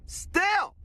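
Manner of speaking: a single word cracking way up high; squeaky outburst. Real, not acted. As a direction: let the word break into a squeak. Ranting at doubters.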